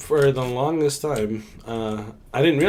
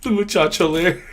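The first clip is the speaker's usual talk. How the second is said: laughing; 8 semitones higher, 3 dB louder than usual. Real, not acted. Joking banter, laughing.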